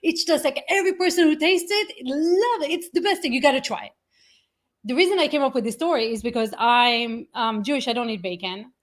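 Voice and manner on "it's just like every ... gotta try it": high, gushing mock pitch; hammed-up breathless enthusiasm of a convert; overexcited seller. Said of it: "We're just coming".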